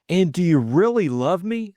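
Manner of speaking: can't believe his luck; asking again in wonder; incredulous. Acted.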